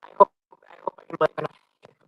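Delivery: garbled robot-like connection; broken digital call audio; robotic glitchy audio. Real, not acted. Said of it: "You sound like a robot".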